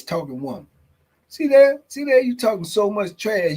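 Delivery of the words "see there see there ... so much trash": higher, louder "see there"; excited teasing. Real, not acted. Streamer teasing the chat member, excited and loud. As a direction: pounce on the joke gleefully.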